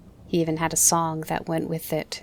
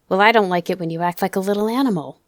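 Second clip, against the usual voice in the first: louder, fast angry retort; angry shout; 8 dB louder, faster than usual. Acted. Father snapping back at his defiant son, shouting. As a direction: snap back hard and fast. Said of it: "he shouted".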